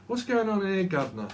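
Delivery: playful mock policeman; cockney bobby. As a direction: tease like an old bobby asking the governor. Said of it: "the old Bill used to say that in Britain".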